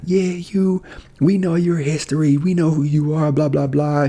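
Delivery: high-pitched imitated heckling, not truly loud. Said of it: "she started getting louder".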